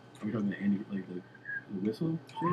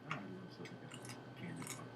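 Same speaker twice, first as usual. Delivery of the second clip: very soft, murmured reply; imitating Bruce Bruce; 2 semitones lower, 15 dB quieter than usual. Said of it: "And he's like".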